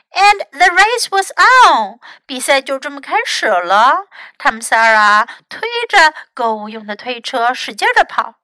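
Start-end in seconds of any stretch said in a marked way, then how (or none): none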